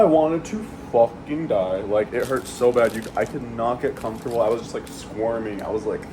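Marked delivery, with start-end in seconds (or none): none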